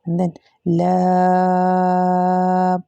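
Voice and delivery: लाम held very long in a recited tone; stretched letter. Shown as a demonstration. Teacher demonstrates to students the long stretch on the letter. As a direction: draw out लाम slowly. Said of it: "लाम को ज़्यादा खींचेंगे".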